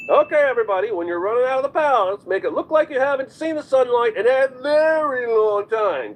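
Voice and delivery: mock film director. Mock film director addressing extras.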